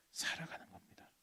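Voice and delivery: hushed whispered sorrow. Acted. Preacher on God's heartbreak, whispered. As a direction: say it softly, breath only.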